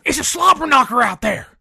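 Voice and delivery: loud, excited wrestling announcer call; J R impression. Host quoting a wrestling announcer about a brutal game, loud and excited. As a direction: belt it out like an old ringside announcer. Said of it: "to quote the good old J R".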